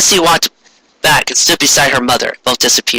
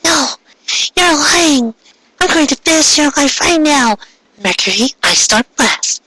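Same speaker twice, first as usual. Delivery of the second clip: put-on higher, furious girl voice; voiced angry sister; snapping in rage; 13 semitones higher than usual. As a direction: snap and threaten her. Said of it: "She snapped".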